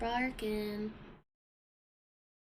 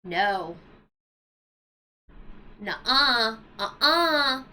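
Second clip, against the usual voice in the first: scolding the cats; 7 dB louder, much slower than usual. Retold or imitated. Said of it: "I look at them and I go".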